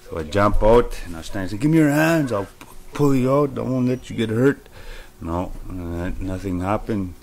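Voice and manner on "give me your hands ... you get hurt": high, urgent offer to pull them out; desperate plea. Retold or imitated. Dreamer begs trapped partygoers to take his hands, high and urgent. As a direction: reassure them while begging.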